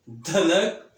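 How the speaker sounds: दनक with a full, heavy, loud द; heavy letter sound. Shown as a demonstration. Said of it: "ये आवाज़ है". (भारी आवाज़ में दनक)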